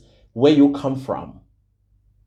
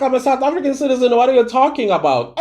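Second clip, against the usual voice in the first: pompous mock quote; 6 semitones higher, much faster than usual. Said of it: "they're like".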